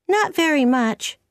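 put-on high-pitched girl's voice; lightly childlike high voice; young girl Anne.

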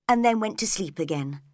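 usual speaking voice

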